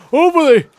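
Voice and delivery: high, booming burst of hope; loud, high-pitched hammed-up hopefulness; emphatic shout. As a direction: belt it out, pitch way up. Repeats word far louder, hamming hope.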